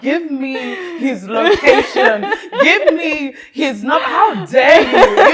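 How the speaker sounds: loud, high outrage breaking into laughter; mock anger. Friend outraged at the cheating husband, mock-furious and laughing. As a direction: build to a laughing, indignant yell. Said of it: "I'm getting angry".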